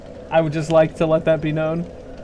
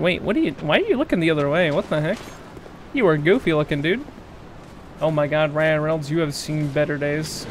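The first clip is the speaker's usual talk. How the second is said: usual speaking voice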